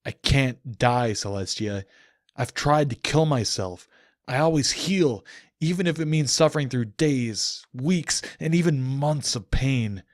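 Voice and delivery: despairing man roaring at the goddess. Acted. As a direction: roar it at her, years of pain behind it. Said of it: "I roared at her".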